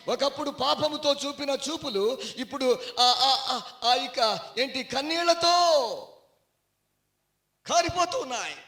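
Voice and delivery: loud, high sermon climax; impassioned pulpit cry; full-force preaching. Real, not acted. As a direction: preach it out with rising fervour.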